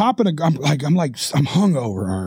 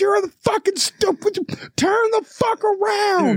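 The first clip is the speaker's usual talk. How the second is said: high, strained imitation of a furious worker; mock enraged yell; vehement screaming; 14 semitones higher than usual. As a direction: bellow the order, dragging it out. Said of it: "vehemently screaming at me".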